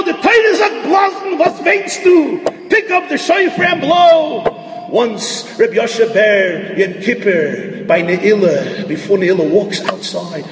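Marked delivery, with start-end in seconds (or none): 0.0-4.9 a rabbi chiding the weeping shofar blower, shouted high; father's stern rebuke. Retold or imitated. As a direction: cry out at him, why are you weeping. Father orders him to blow.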